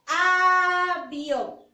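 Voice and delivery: avión said loud, with the a opened out; loud teacher model of avión; emphasized model word. Shown as a demonstration. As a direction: pronounce avión clearly and loudly for a child. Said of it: "Inicia con la vocal".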